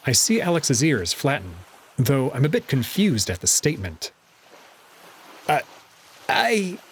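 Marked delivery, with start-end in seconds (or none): 5.5-6.8 stammering, caught out; guilty stutter. A narrator's reading. Alex caught out, stammering guiltily. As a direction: stumble over the words guiltily. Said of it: "stutters in the most guilty way imaginable".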